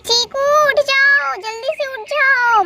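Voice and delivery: drawn-out, high wake-up call. Acted. Sister waking her sleeping brother, calling out.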